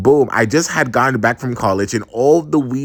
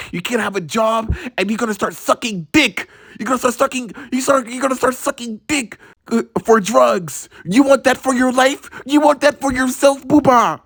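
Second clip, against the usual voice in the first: mock-mom warning escalating to a high-pitched frenzy; lecturing mom; 6 semitones higher than usual. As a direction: imitate a mother spiralling into worst-case scenarios, climbing higher. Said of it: "She would keep on telling me".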